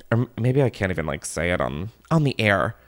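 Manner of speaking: ordinary delivery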